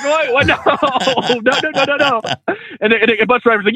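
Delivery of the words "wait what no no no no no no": high-pitched panicked protest, played for laughs; mock panic, rattling off the refusals up high; hammed-up horrified refusal; comic alarm. Acted alarm at lady joining him.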